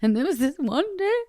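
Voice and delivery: lightly voiced grown-up son confiding; son in therapy. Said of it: "talking to his therapist going".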